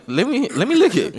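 high-pitched mock begging; imitated insistent girl. Man mimicking the girl begging for his earwax, insistent.

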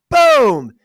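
very loud, drawn-out impact noise; shouted crash imitation; explosive boom. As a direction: boom it out high and long. Imitates the blow to the wall.

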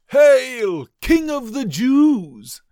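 high, slow, sneering hail; mock him as a fake king, loud and drawn out; jeering soldiers, slow and high. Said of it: "they mocked".

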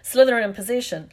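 match commentator's projected voice; sports commentary. A narrator's reading. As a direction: announce it over the crowd. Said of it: "Lee Jordan was saying".

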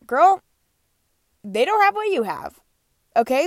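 put-on loud, high, sassy girl talk; sassy self pep talk. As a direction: hype yourself up, loud and sassy. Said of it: "I tell myself".

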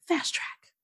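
whispered hero-name reveal; mock dramatic whisper. Retelling the heroine naming herself, mock dramatic whisper. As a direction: breathe it out like a big reveal.

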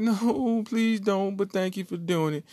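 put-on high, squeaky girly voice; mock girl. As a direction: sweetly turn him down, high. Girlfriend mock reply.

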